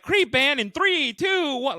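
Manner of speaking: high, loud band count-in; calling out a count-in. Retold or imitated. Host cueing the house band to play, called out high. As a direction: draw out the countdown like a show host.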